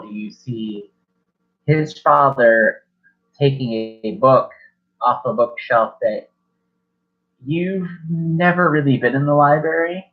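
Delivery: normal talking voice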